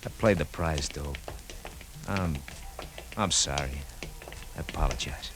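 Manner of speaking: ashamed apology. Acted. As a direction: mumble it out, embarrassed.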